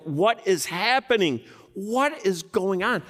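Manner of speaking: baffled disbelief; bewildered. Retold or imitated. Disciples stunned as Jesus touches the leper, bewildered cry. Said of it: "holding their heads like".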